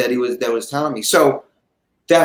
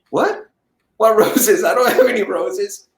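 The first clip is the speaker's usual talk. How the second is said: high, startled confusion with a laugh; baffled; 5 semitones higher than usual. Retold or imitated. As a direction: jump up in pitch, puzzled. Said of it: "And I say".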